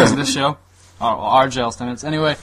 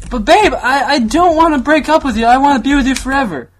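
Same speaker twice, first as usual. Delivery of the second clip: put-on high pleading girlfriend voice; mocking the ex pleading not to break up; Kaylee; 10 semitones higher, 5 dB louder than usual. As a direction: whine like a clingy girlfriend begging. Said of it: "I'll be Kaylee".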